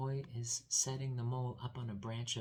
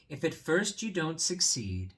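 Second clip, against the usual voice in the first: loud, raised proverb; mole's proud saying; 6 semitones higher, 9 dB louder than usual. Acted. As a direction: announce it grandly. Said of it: "said the mole".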